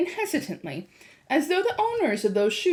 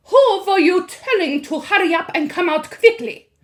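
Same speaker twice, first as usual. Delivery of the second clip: loud, high witch snapping; witch snap; 9 semitones higher, 9 dB louder than usual. A narrator's reading. Witch voicing.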